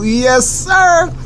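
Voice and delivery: high eager yes sir; excited yes sir. Pretend. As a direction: answer with pumped-up glee. Answers own question.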